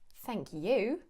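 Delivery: "Thank you." with "you" stressed and rising. Shown as a demonstration. Replying to an invitation, stress on "you". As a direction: lift and stress "you" to turn the thanks back. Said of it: "I'm emphasizing that really I should be thanking them".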